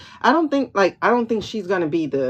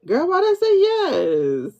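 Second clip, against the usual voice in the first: disbelieving; 10 semitones higher than usual. Real, not acted. Reacting to her own reading.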